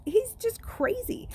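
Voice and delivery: put-on a sneering boy hisses it; hissed. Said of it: "Jackal Boy hissed".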